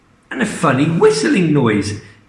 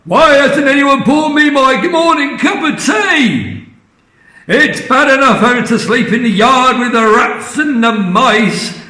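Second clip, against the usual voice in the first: furious shout; 10 semitones higher, 5 dB louder than usual. Acted. Grandma bellowing at the family for her tea, loud high shout. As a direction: roar down demands like a tyrant granny. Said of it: "And she shouted".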